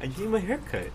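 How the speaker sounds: mock innocent. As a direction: play it all small and innocent. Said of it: "So innocent".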